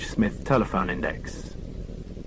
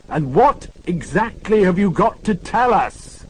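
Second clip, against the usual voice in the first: slow, loud, higher over-articulated question; bawling; 10 semitones higher, 5 dB louder, slower than usual. Acted. Morse bawling at a deaf old lady. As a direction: bellow each word slowly for a deaf listener. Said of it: "bawled Morse".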